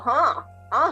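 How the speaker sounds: ordinary talk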